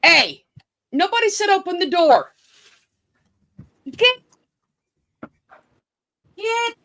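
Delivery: high, quick scolding; scolding the dogs. Real, not acted. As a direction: tell the dogs off sharply.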